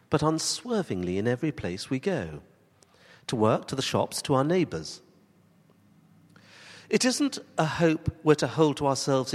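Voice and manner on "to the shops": usual speaking voice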